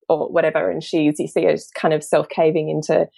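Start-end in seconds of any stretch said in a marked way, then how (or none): none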